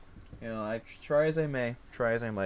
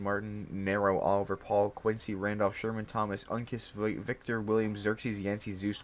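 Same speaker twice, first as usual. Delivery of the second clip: ordinary talk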